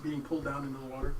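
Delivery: usual speaking voice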